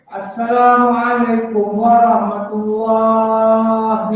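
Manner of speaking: very slow, drawn-out "Assalamualaikum warahmatullahi"; careful teaching demo. Shown as a demonstration. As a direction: spell it out slowly for the class. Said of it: "Macam ni".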